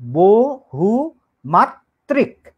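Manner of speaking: বহুমাত্রিক very slowly, split as bo-hu-mat-trik; বহুমাত্রিক drawn out, each syllable separate. Shown as a demonstration. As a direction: count out the syllables of বহুমাত্রিক with gaps. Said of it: "বহুমাত্রিকটাতে কি হচ্ছে".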